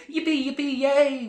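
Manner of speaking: high, loud mock cheer; hammed-up glee. Kid imagining skipping off with the car, gleeful. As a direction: whoop like a delighted little kid.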